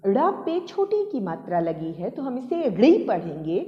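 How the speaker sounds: ordinary delivery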